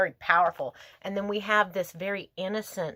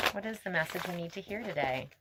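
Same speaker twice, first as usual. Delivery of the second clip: softer, faster murmured repeat; 8 dB quieter, faster than usual. Real, not acted. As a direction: tuck the question in quietly. Repeats question to spirits softly.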